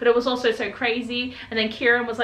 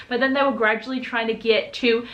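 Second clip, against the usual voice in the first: normal talking voice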